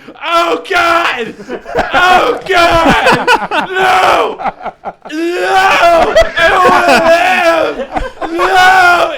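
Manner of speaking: very high, very loud mock scream, laughing through it; hammed-up anguished wail. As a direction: wail it like a melodramatic wreck, slowing on the last cry. [name] despairs, acted out mid-laugh.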